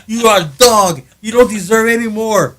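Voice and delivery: hammed-up mean host berating the guest; mock harsh host. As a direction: bark it like a cruel host at a guest. Jokingly acts the harsh host.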